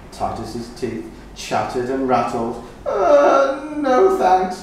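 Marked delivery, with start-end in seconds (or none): 2.9-4.6 much higher, louder mock sobbing wail; mock sob. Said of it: "he sobbed".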